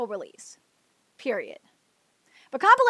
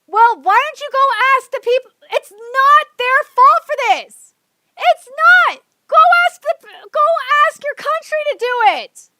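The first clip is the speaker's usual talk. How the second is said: louder, higher yelling; angry shouting; 5 semitones higher, 5 dB louder than usual. Real, not acted. Streamer yells at fans demanding a worldwide release, loud. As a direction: shout them down.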